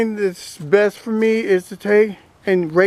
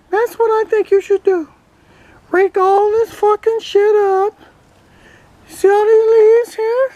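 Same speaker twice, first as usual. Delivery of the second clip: higher, cheeky toy duck voice; duck puppet voice; 10 semitones higher than usual. Acted. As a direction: voice the toy duck answering back. Ducky keeps giving orders.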